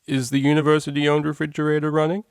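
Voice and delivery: mock caller voice. Prank caller trying again.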